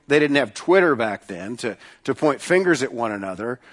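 normal talking voice

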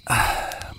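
soft breathy sigh; tired sigh. Acted. Camper by the fire sighs, weary.

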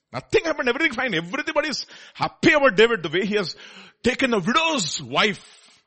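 loud, high, mocking sermon voice; sarcastic preaching. Real, not acted. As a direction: rail against it sarcastically. Preaching at full force, sarcastic.

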